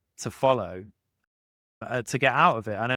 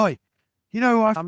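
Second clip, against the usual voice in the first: high, loud mock road-rage yell; road rage shout; 10 semitones higher, 7 dB louder, much faster than usual. Man in a Vauxhall Corsa shouting at another driver in road rage, loud and high. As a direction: bellow it at the other driver like an angry motorist. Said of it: "He's like".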